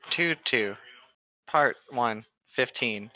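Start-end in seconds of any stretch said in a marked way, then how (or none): none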